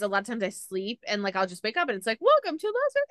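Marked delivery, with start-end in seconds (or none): 2.2-3.1 lightly voiced cabin crew, pitched up high; sleepy traveller mimics the crew's landing welcome, mock announcement voice; mock flight announcement. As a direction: chirp it like a flight attendant greeting passengers.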